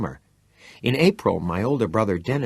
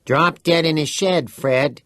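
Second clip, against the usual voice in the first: higher, louder cheeky rhyme; smart comeback; 7 semitones higher, 5 dB louder, slower than usual. A narrator's reading. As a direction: toss the rhyme back with a grin. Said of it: "Teddy returned smartly".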